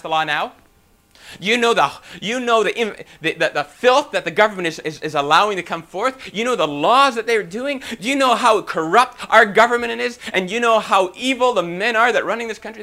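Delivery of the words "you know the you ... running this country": loud, high-pitched mock tirade against government; imitated indignant griping. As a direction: rail like an outraged complainer, piling up grievances. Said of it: "This is how we talk".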